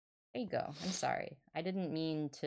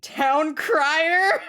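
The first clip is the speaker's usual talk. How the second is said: laughing; 12 semitones higher, 14 dB louder than usual. Real, not acted. Laughs while announcing.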